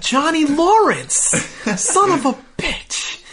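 high, slow, drawn-out greeting shout; calling out. Retold or imitated. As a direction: stretch out the friendly yell. Dan greets old rival loudly.

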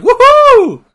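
very high, loud whoop; yelling cheer. Retold or imitated. Telling how an uncle cheered at the show, yelled whoop. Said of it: "like, yelling".

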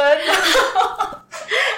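laughing at the applause; bright laugh. Real, not acted. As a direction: laugh out at the memory.

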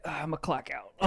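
soft, lazy sigh of a tired dick; a hint of a worn-out worker; mock sleepy voice. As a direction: voice it clocking out, drained. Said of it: "your dick's like".

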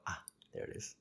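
breathy whispered realization, dropped low; whispered aha. Retold or imitated. To himself on spotting the thread, a soft whisper. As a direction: let it slip out as a hushed discovery. Quoting his own inner recognition.

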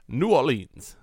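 lightly put-on New Orleans accent; a weak try at New Orleans drawl. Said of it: "That's why I said I want a good one".

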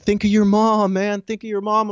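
high, emphatic imitation of pleading friends; urgent appeal. Buddies restraining their friend, urgent retold plea. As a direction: lift the pitch and urge him hard. Friends urging him to calm down.

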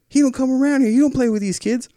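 high, insistent mimicked voice; confrontational neighbor. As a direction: imitate the neighbor arguing. Neighbor's words in the quarrel.

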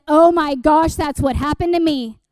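loud, amazed outburst; thrilled discovery. Retold or imitated. Speaker retells recognizing herself in the verse, loud excitement. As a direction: burst out as if you just found it.

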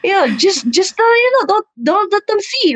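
high-pitched, halting crafty auntie; old lady. Retold or imitated. Old mom says hide it from referees, impersonated. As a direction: imitate grandma sharing a sneaky tip. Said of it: "she's an old lady".

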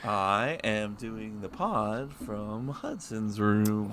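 slow, soft mock surfer drawl; imitating surfer. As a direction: lazily imitate a California surfer. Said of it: "sound like a surfer from California in the nineteen sixties".

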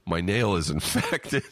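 breaking into real laughter mid-phrase; laughing. Real, not acted. Podcaster reading out a listener's infected nail diagnosis, laughing. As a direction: try to finish the sentence while cracking up. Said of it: "Sorry for laughing".

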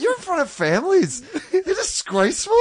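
high, laughing outrage at the players; mock scolding. Comedian mimicking his reaction to drunk players at family day, laughing mock scolding. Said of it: "I'm like".

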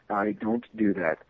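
soft, slow, low mumble; sheepish mumble. Retold or imitated. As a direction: mumble the refusal under your breath. His sheepish refusal.

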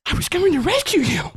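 high, whiny plea; Brantley, distressed. Acted.

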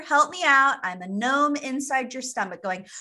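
normal talking voice

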